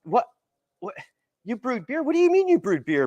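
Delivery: retelling his own stunned what, what, what, rising high; incredulous, a bit fast at the end; shocked disbelief. Retold or imitated. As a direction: pitch jumps up in mock shock. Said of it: "And at first I'm shocked".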